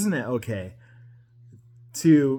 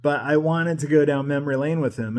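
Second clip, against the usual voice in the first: ordinary talk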